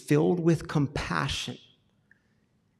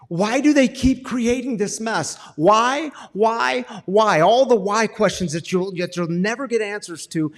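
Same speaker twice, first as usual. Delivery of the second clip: higher, louder pleading why after why; exasperated outburst; 6 semitones higher, 7 dB louder than usual. Pretend. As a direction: hammer the whys in frustration.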